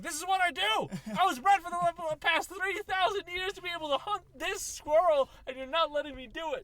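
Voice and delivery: high, loud righteous dog voice; a high-voiced dog defending its instincts; protesting dog. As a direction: plead like a hunting dog denied.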